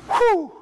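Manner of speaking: whew. Retold or imitated. Exasperated outburst at the remark.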